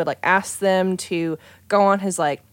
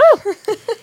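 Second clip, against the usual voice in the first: high laugh; 5 dB louder than usual. Real, not acted. Laughing at the free-food joke.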